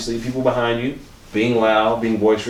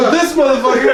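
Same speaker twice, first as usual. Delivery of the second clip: high, loud imitated jeer; hammed-up incredulous heckle; mock outrage; 5 dB louder than usual. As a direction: point the finger with a high-pitched shout. Acting out passing on a roast.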